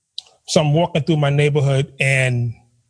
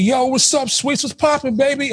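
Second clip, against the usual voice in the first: higher, street slang greeting; 8 semitones higher than usual. Retold or imitated. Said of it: "you just code switch".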